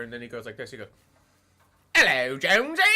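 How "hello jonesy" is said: mock British accent, louder and higher; imitating an actor's over-the-top British accent; overt British accent. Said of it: "he starts talking in a British accent".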